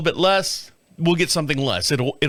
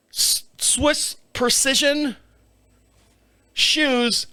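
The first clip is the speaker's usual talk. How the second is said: slow, raised, mock-impressed "Swiss Precision"; mock grand brand name; 7 semitones higher, much slower than usual. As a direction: read the label like an advert.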